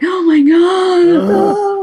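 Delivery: slow, high, stunned gasp of awe; awestruck. Retold or imitated. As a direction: drag it out in disbelief. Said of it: "I was like".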